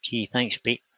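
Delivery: ordinary talk, nothing marked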